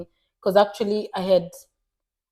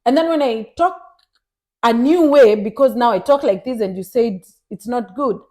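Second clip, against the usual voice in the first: ordinary talk, nothing marked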